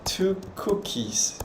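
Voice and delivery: quiet, slow English order; slow, soft order. Shown as a demonstration. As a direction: order the cookies slowly and softly. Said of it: "aí eu boto lá".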